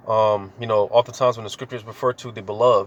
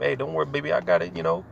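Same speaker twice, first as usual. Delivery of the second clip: higher, gentle comforting voice; husband comforting his wife, tender and high; tender reassurance; 6 semitones higher, faster than usual. Retold or imitated. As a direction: soothe her like a husband. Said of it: "how Jake talked to their rib".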